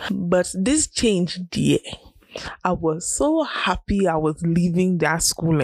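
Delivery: ordinary talk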